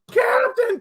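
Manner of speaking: very high-pitched cry for help; imitated panicked plea; mock wailing call. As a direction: whine it out like a helpless child. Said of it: "he's going".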